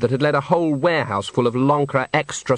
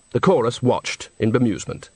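ordinary delivery

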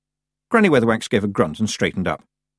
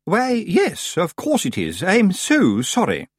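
high, drawn-out grand-lady courtesy; falsely polite apology; 9 semitones higher, slower than usual. A narrator's reading. Missus Earwig apologises to her rival, chilly politeness. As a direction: apologise with icy, formal sweetness. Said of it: "formal and chilly way".

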